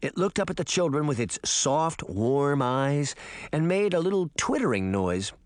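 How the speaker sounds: ordinary delivery, nothing marked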